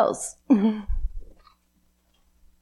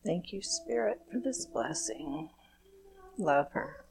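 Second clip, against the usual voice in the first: calm, measured blessing prayer; gentle ceremonial invocation; blessing ritual; 2 semitones lower, 6 dB quieter than usual. Acted. Leads a chakra blessing ritual.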